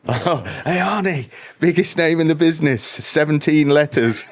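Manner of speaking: high-pitched mock celebrity heckle; Dennis Pennis. Comic retelling a heckle at Arnie, high mocking call. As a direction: call out high, drawing out the punchline. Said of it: "where he goes".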